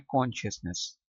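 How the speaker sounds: British "कॉन्शियसनेस" starting with a rounded kon; British-accent "कॉन्शियसनेस"; British pronunciation. Shown as a demonstration. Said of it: "British accent में".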